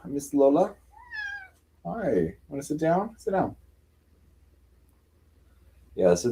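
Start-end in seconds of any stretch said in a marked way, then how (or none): 0.1-3.6 high, gentle coaxing. Real, not acted. Asking her cat Lola to sit down, high gentle baby talk. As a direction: softly coax the cat to sit.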